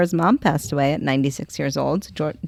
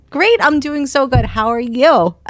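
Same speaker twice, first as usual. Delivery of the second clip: fake cheerful; 7 semitones higher, 4 dB louder, slower than usual. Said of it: "looking at him like".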